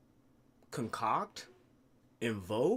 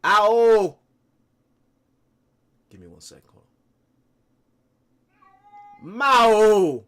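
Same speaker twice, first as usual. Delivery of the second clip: loud call across the room; loud drawn-out calls to a pet; calling the cat; 6 semitones higher, 11 dB louder, much slower than usual. Real, not acted.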